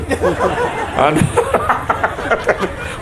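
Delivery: high burst of laughter at his own joke. Real, not acted. Preacher laughing at his own joke to the crowd. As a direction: laugh it off after teasing the host. Joking about the host's plain water.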